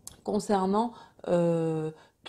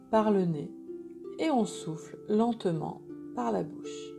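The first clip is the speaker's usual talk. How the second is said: guided meditation; 5 dB quieter than usual. Acted. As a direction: guide them gently into calm.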